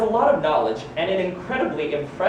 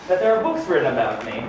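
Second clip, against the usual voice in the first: ordinary talk, nothing marked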